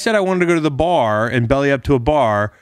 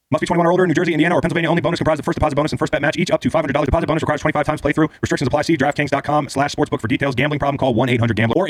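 rushed fine-print disclaimer; fast legal disclaimer; 4 semitones higher, much faster than usual. Acted. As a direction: rattle off the legal terms fast.

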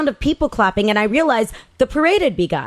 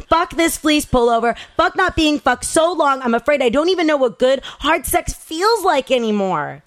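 plain reading voice

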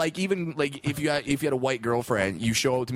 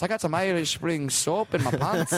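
higher, lilting mock Irish brogue; Irish accent; 5 semitones higher than usual. Chatting up a lass in the pub, mock Irish accent. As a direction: flirt in a hammed-up Irish accent. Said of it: "I just walk in the Irish pub".

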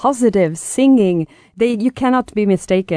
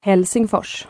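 Swedish pronunciation; slower than usual. Shown as a demonstration. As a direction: say Helsingfors like a Stockholm Swede. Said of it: "you say that with a good Swedish accent".

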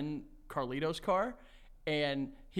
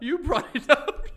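laughing; 9 semitones higher, 7 dB louder, slower than usual. Real, not acted. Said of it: "teasing, laughing".

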